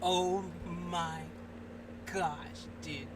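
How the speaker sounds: low, halting, stunned murmur; quietly floored, with pauses between words. Real, not acted. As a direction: let each word drop out, dumbfounded. Stunned by the stopped video.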